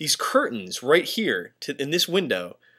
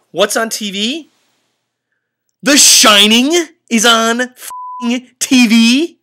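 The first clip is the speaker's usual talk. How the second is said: higher, much louder, slow and stretched; horrified outrage; 9 semitones higher, 10 dB louder, much slower than usual. Real, not acted. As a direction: bellow it like the universe is mocking you. Shining on TV in haunted room.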